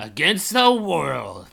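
ordinary talk, nothing marked

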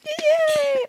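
very high, squealed cheer; gleeful cheer. Real, not acted. Contestant hearing her guess is correct, high gleeful cheer. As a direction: squeal with delight at winning. Told she is right once more.

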